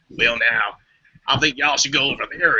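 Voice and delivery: put-on how she thinks Americans talk, Western Southern accent; mock Western Southern accent. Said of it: "the Western Southern. So that's what I".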